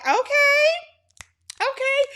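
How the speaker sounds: very high-pitched group-reaction okays; imitated intrigued reaction. Recapper as everybody hearing about the kiss, intrigued. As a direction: mimic onlookers, pitch shooting right up.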